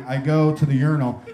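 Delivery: usual speaking voice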